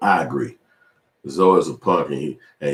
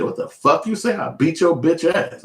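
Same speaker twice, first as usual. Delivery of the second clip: mock angry retort; 10 semitones higher than usual. Retold confrontation with coworker.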